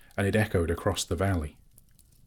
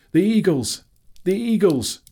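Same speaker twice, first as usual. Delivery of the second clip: high, loud cry of the eagles; ringing high shout; excited shout; 12 semitones higher, 8 dB louder than usual. A narrator's reading. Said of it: "he shouted".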